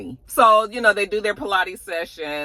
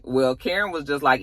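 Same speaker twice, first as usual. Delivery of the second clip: ordinary delivery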